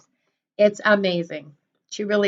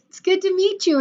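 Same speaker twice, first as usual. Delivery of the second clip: ordinary talk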